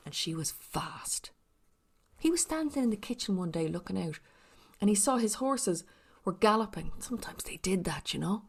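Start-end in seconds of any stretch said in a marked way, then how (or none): none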